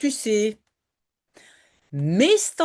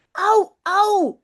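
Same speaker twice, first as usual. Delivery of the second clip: put-on high, louder yelp of pain from a bitten boy; 9 semitones higher, 5 dB louder than usual. Jip yelps as Janneke bites his leg, high pained yelp. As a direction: cry out sharply as if just bitten. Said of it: "gilt Jip".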